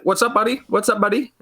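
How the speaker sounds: imitating a high-pitched, chirpy greeter; security guard's greeting. Retired cop security guard greeting whoever walks in, high-pitched mimicry. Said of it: "he's like".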